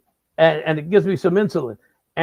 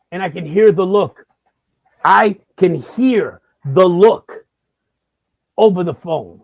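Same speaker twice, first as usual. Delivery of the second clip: louder, dragged out with each word hit; emphatic repeat; 2 semitones higher, 6 dB louder, slower than usual. Real, not acted. Comedian hammering the punchline, slow and loud. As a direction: lean hard on it, slowly.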